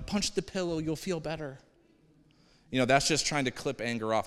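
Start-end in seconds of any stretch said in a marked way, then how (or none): 0.1-1.6 soothing shrink. Retold or imitated. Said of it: "the shrink comes over".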